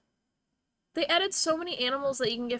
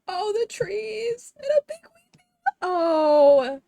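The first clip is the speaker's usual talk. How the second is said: high, gasping, tearful gush; overcome with delight; 9 semitones higher, 7 dB louder, slower than usual. Real, not acted. Streamer seeing the venue's trees, overcome with delight. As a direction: gasp and coo, about to cry.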